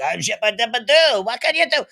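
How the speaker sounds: high, loud cartoonish French accent. Comedian quoting his song about a French actor, cartoon French accent. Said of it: "I do it in a very cartoon".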